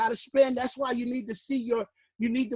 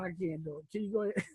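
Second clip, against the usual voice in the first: much softer and lower, spent; out of breath; 7 semitones lower, 9 dB quieter, faster than usual. Real, not acted. Teacher hands over to Lynn, out of breath and spent. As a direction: gasp it out, no energy left. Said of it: "I'm already out of breath".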